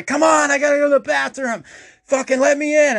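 high, loud desperate whine; desperate pleading. Retold or imitated. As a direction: beg to be let in, near tears. Locked out, desperate to pee.

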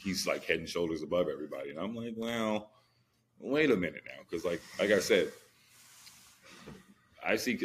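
ordinary delivery

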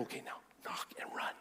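whispered conspiratorial cue; very soft, hushed go-signal; secretive instruction. Retold or imitated. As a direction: whisper the plan to your accomplice. Secret instruction near the car.

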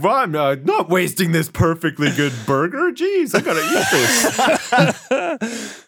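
rising greedy exclamation; greedy fat guy. Pretend. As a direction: grumble then squeal with greed. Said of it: "He was like".

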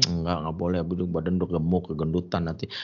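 low, soft imitation of a refusing wife; quietly voiced mock scolding; istri melarang. Wife refusing food, retold. (istri melarang dengan suara pelan)

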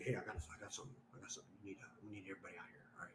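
very soft, low confidential murmur; hushed phone call. Retold or imitated. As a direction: act out a discreet call for backup. Duty driver's phone call, acted.

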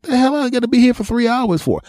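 shocked high voice, indignant at the wait; indignant disbelief. Retold or imitated. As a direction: squeak it out in outrage. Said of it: "I'm going".

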